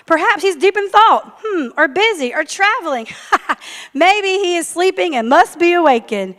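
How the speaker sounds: high, loud sing-song ridicule; mocking taunt. A narrator's reading. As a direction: poke fun at their silent god. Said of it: "Elijah began to taunt them".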